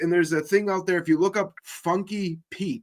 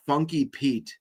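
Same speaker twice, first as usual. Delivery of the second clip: usual speaking voice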